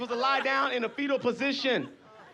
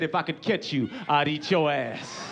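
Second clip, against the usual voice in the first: low menacing bear voice; bear's threat; 6 semitones lower than usual. Retold or imitated. Bear threatens the viewer at home, low menace. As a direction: warn it low, slowing into the end. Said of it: "And he looked right in the living room and said".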